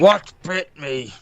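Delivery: put-on in-character outburst; captive character voice. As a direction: demand an answer in character. Player voices the captive again.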